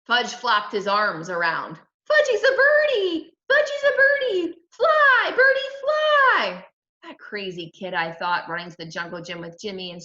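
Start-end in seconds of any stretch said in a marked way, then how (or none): 2.1-6.7 put-on high, loud toddler cries, last word drawn out; excited little kid. A narrator's reading. Little brother pretending to fly, excited high shout. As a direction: squeal it like a kid playing bird.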